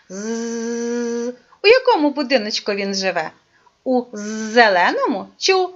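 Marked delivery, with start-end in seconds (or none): 0.1-1.3 "З" as a soft drawn-out zzz, like a mosquito; buzzing z sound. Shown as a demonstration. Teacher showing the mosquito's song, soft buzz. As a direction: hum "З" quietly on the teeth. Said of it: "Це символ якого звука?".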